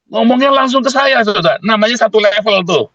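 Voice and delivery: high insistent preaching; emphatic preaching. Real, not acted. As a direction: drive it home to the congregation.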